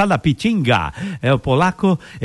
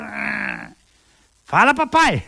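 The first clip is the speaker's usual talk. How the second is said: commanding the dog; 4 semitones higher, much slower than usual. Acted.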